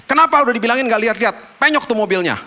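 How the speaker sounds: high, loud, fast scolding; pretend angry scolding. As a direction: scold the careless son sharply. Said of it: "marah-marah sama dia".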